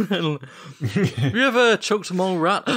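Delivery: asked through laughter; giggling through the question; laughing. Real, not acted. Laughing mock grant question.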